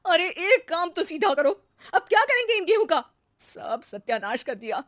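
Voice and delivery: irritated complaint; exasperated scolding. Acted. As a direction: throw up your hands at her. Verma scolds maid over wheat.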